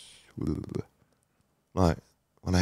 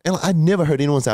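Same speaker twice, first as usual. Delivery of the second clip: emotional outburst; 11 semitones higher, 6 dB louder than usual. Real, not acted. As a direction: voice jumps high and loud with feeling. Emotional about never hearing praise.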